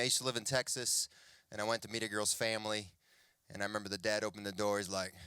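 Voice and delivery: ordinary talk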